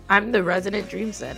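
ordinary delivery

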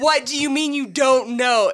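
loud, high disbelieving demand; retold frustrated shout; exasperated demand. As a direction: snap back in disbelief. Driver exasperated at kid.

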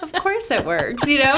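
amused high voice, slowed. Retold or imitated. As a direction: laugh it out playfully. Mock reply, laughing.

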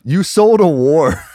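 "sold" hit hard, slow and loud; emphatic mock retort; punchy emphasis. Shown as a demonstration. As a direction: land the comeback slowly. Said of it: "what a fucking amazing response".

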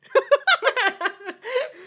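squealing laugh at own joke; high laughing burst. Real, not acted.

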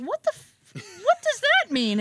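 high, drawn-out disbelief; shocked question. Retold or imitated. Doctor reacts in shock, retold.

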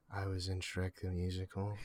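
sheepish low admission. Acted. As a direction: admit it under your voice, embarrassed. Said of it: "just a little louder".

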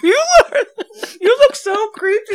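high, loud through real laughter; helpless laughing shout. Real, not acted. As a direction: squeal it out while cracking up. Cracking up at ASMR attempt.

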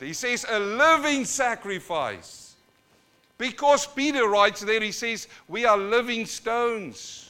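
high, loud pulpit exhortation; full-force preaching. Acted. Preacher begs his churches to give their bodies, loud impassioned exhortation. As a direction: urge the congregation at the top of your voice.

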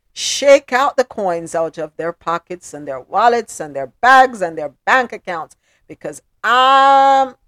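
loud, emphatic rallying rant; fired-up urging. Real, not acted. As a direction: egg the lawyer on with punched, stretched words. Host urges lawyer to sue.

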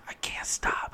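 hushed whisper in a hunting blind; boy whispering to his dad in the blind. Retold or imitated. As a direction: whisper so the buck won't hear. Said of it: "'cause they're being quiet".

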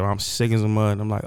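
ordinary talk

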